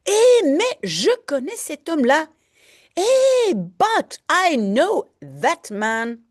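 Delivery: a teacher acting out the jailer's cry; mild mock shout. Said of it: "So he screams out".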